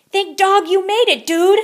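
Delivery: high, loud relieved exclamation; exclaiming. Acted. Big pup greeting his friend on the bus, exclaiming loud and high. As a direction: burst out with relief. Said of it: "exclaimed Don Diego".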